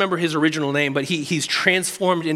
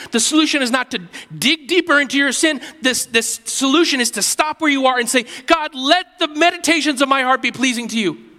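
full-force preaching; 6 semitones higher than usual. Acted. Pastor urging the family to repent, fervent full-force preaching. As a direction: preach it hard, rising to a fervent plea.

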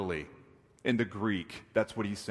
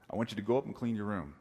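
quick, low and softer; casual parental order; 7 semitones lower, 5 dB quieter, faster than usual. Acted. Dad telling child to tidy up, quick and casual. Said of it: "we see an emphatic repetition".

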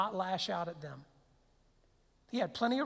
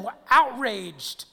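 outraged; 3 semitones higher, 11 dB louder, slower than usual. Pretend. Mock outrage at Pharisees.

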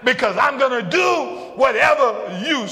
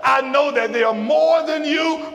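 full-force preaching; 4 semitones higher than usual. Acted. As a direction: build the sermon toward the climax. Preacher voicing God, building.

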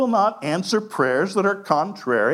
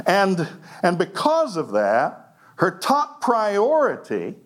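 usual speaking voice

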